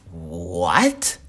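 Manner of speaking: drawn-out questioning what, rising sharply; stretched, incredulous what; baffled reply. Acted. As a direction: act a girl's disbelieving question. Girl turns around, baffled.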